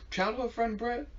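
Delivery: put-on high, startled "Childhood friend Brett?"; surprised question. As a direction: ask it in amazement. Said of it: "He's like".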